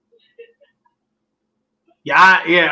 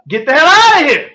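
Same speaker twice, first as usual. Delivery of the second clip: high, loud kiss-off; fed-up dismissal; 10 semitones higher, 6 dB louder than usual. Acted. Coach losing patience with a quarterback, fed-up dismissal. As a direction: throw it out loud with pitch shooting up.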